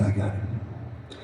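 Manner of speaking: slow whispered eureka; whispered realization. Retold or imitated. Speaker realizing how to reach Ray, slow whisper. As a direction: hush it out slowly, idea landing. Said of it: "And I said".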